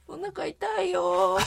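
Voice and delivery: put-on English-accented Japanese. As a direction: stretch the vowels like an English speaker in Japanese. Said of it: "英語なまりの日本語".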